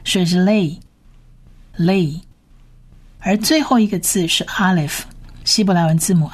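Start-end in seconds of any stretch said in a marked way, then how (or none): 1.8-2.2 "lay" as l plus an A vowel, on its own; A-vowel pronunciation. Shown as a demonstration. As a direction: model "lay" as a single separate syllable. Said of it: "我们是发成A的音". (单独示范“lay”，元音发“A”)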